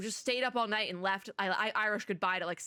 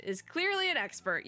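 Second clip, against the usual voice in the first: higher-pitched, hammed-up Texas accent; attempted Texas accent; 7 semitones higher than usual. Resident vouching for the little lady, attempted Texas accent. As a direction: put on a shaky-fit Texas cowboy accent. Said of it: "I can't even do that with the accent".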